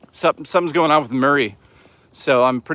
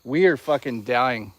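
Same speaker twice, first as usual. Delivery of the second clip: ordinary talk, nothing marked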